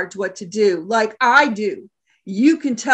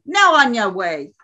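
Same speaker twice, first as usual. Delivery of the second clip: ordinary delivery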